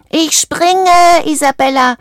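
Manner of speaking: high, loud, drawn-out farewell call; calling out goodbye. A narrator's reading. Valentino leaping off Isabella's back, shouting goodbye. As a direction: holler it from the air, voice way up.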